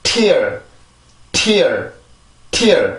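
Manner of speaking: "tear" with crisp aspirated T, falling; English pronunciation drill. Shown as a demonstration. Teacher models the word for learners, drilled English "tear". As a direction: release the T, then glide into r on "tear". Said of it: "발음을 한번 해볼게요".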